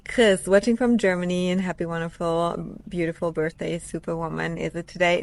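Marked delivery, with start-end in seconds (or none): none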